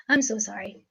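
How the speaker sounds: normal talking voice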